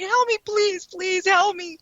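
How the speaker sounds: high, pleading cry for help, mildly acted; imitating a scared girl. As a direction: retell the girl begging through the door. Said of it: "the little girl's looking at me".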